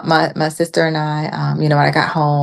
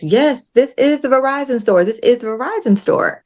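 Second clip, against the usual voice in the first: higher-pitched, retold insistence; firmly repeated assurance, pitched up; insistent; 7 semitones higher than usual. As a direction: insist on it, imitating a stubborn driver. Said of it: "he insists".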